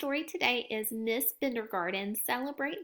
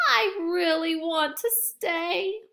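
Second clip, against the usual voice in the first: put-on pleading child; 5 semitones higher, 4 dB louder, slower than usual. Child hiding under desk pleads.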